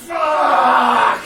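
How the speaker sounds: high, loud yelp of a curse; a high shouted curse of panic; comic shout. Retold or imitated. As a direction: blurt it out in sudden horror. Inner scream at handshake.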